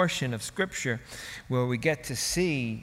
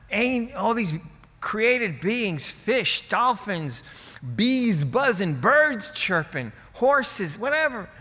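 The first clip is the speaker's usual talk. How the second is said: higher, louder pleading list; can't believe it isn't enough; incredulous protest; 6 semitones higher, 3 dB louder, slower than usual. Pretend. Protesting to God about creation.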